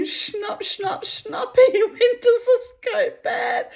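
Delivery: very high, squeaky snapping noises through laughter; laughing. Real, not acted. Imitating snapping bones during a film, laughing. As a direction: crack up while imitating brittle bones.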